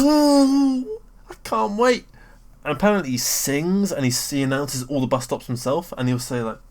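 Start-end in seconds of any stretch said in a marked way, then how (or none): 0.0-2.1 much higher squeal of delight; squealing ooh, can't wait; giddy excitement. Retold or imitated. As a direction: gush like an excited fan. Excited about hearing the driver.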